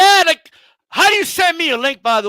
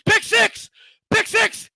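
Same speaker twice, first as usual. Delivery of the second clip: mock commentator cry, much higher; excited call; 9 semitones higher, slower than usual. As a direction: yell it like a fan in the stands. Calling out a pick six.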